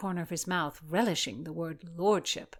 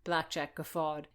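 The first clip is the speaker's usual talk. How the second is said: plain reading voice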